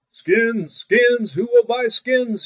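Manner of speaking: high, loud peddler's call; street cry. A narrator's reading. Great Claus calling out to sell hides in town. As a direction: call your wares down the street. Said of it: "he shouted again".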